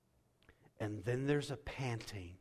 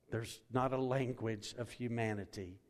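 ordinary talk, nothing marked

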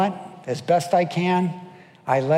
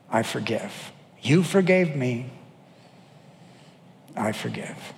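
model forgiveness prayer; 4 semitones lower than usual. Shown as a demonstration. Pastor modeling a forgiveness prayer for the church, hushed.

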